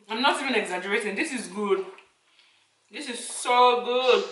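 eating and talking at once; talking while chewing. Real, not acted. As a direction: mouth partly full of food. Seen 0: Puts food in mouth at -3; jaw works while talking after.

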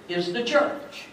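breathy whisper on "the church"; whispered reveal; hushed. Real, not acted. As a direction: drop to a whisper for the answer. Hushed punchline.

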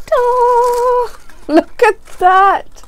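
high, slow, delighted reveal; a gleeful ta-da, drawn out high; excited squeal. Real, not acted. Unboxing a gift, squealing at it.

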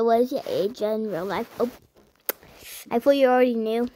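ordinary talk, nothing marked

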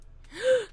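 a sudden high gasp of shock; sharp gasp. Real, not acted. Gamer gasping as her Sim sees the fight. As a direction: gasp like she can't believe her eyes.

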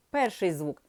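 normal talking voice

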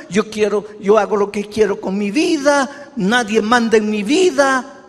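imitating a defiant sinner; defiant quote. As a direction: throw it out like a rebellious sinner. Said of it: "y andamos diciendo".

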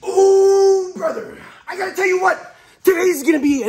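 high loud laughing hype; hyped greeting. Real, not acted. Calling out to his friend, loud and high with a laugh. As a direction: greet him like a buddy.

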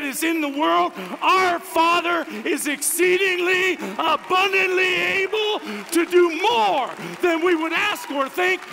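high, loud preacher building to a shout; full-force preaching. Real, not acted. Preacher exalts the Father to the congregation, full-force preaching. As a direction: drive each phrase harder toward the climax.

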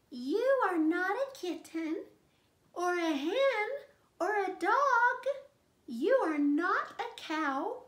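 put-on baby bird happy. Baby bird recognizing his mother, high happy chick voice. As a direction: say it like a delighted chick.

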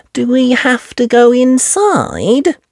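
put-on high, slow little girl voice; a small piggy complaining; Peppa piglet voice. Peppa complaining to friends about the noisy daddies.

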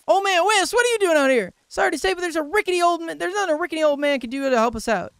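high, loud, fast chatter; blunt villager. Acted. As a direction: rattle it off loudly at someone. Voices villager meeting old man.

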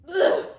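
loud disgusted ugh; disgusted groan. Retold or imitated. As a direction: imitate the creature's sputter with disgust. Imitating salamander sputtering.

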